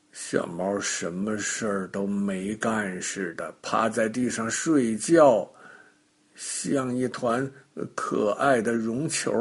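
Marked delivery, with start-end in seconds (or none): none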